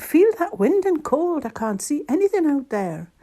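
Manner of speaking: loud, high complaint about the cold; high exclaiming, easing slightly; crying out. A narrator's reading. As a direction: call out into the wind. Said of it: "she cried".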